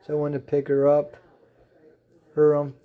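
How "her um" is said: ordinary delivery, nothing marked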